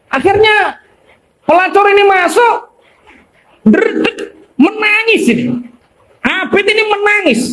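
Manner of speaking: ceramah memuncak. A narrator's reading. Puncak kisah diceritakan.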